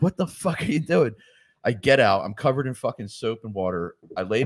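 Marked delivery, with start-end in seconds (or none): 0.0-1.1 wife's alarm. Retold or imitated. Wife voiced in story.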